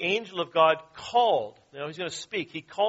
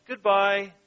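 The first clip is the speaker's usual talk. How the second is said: despairing goodbye; 4 semitones higher, 5 dB louder than usual. A narrator's reading. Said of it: "with a last despairing cry".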